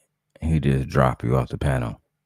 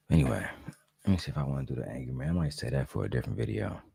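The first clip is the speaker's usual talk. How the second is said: softer, hushed early-morning talk; quiet at night; 4 semitones lower, 11 dB quieter than usual. Real, not acted. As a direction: keep it low so the house sleeps. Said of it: "If y'all hear me talking low".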